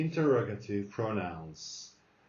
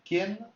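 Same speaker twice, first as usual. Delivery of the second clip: ¿Quién? high and loud, rising; rising question tune; 10 semitones higher, 5 dB louder than usual. Shown as a demonstration. Teacher stressing the question accent, high and loud. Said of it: "So accent".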